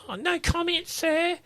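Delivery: high, cheeky mock tone; mocking lippy voice. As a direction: sing it out high and smug.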